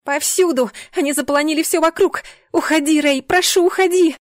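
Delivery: high, loud, desperate cries; panicked shouting. A narrator's reading. Heroine amid demons to her guard, shouting in panic. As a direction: cry out, begging him to get away.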